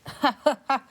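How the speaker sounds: a ghost laughing off the adventurer; ghostly chuckle. Acted. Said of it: "The other ghost chuckles".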